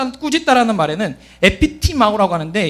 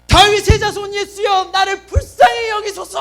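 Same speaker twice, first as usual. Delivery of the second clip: desperate high-pitched shout for mercy; shouting at full throat; 10 semitones higher than usual. Acted. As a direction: cry out as if to burst your throat. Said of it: "목이 터져라 고래고래 소리쳤어요".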